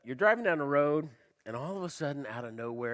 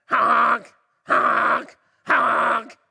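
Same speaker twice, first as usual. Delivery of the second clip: loud nasal honk honk honk; mock horn honks; imitated car horn; 9 semitones higher, 14 dB louder, much slower than usual. Impatient driver honking horn.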